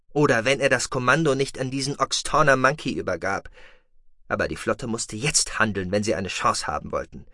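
plain reading voice

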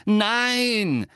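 loud, high, drawn-out refusal; angry. A narrator's reading. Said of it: "sagte Harry zornig".

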